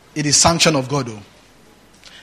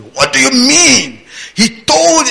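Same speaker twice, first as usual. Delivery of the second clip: mock challenger; 8 semitones higher, slower than usual. Followers challenging you.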